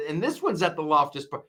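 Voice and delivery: ordinary talk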